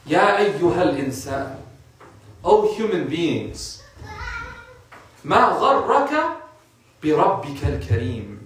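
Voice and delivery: slow, drawn-out Arabic recitation; Quran recitation. Real, not acted. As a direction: recite the verse slowly, stretching the vowels.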